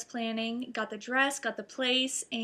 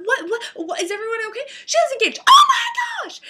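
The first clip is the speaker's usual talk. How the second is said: from high worried questions building to a huge scream; rising excitement; 13 semitones higher, 13 dB louder than usual. Retold or imitated. Next group of relatives bursting in, building to a scream. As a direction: climb from alarm to a joyful shriek. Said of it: "they're like".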